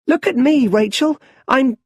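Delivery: high, loud, playful call to a friend; a boy shouting gleefully from the top of the well; excited child call. A narrator's reading. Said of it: "climbs onto the well and laughs".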